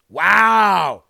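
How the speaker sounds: loud stunned wow; shocked exclamation. Real, not acted. Host reacts to the abortion number, loud shocked wow. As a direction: hit the wow in outrage.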